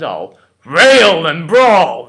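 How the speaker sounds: huge, drawn-out high bellow; railing and brawling. Acted. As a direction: bellow it out, stretching each word. Petruchio demonstrates his railing.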